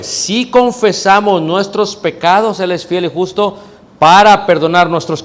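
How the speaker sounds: fervent sermon reading of scripture; preaching. Real, not acted. Preacher reads verse, rising.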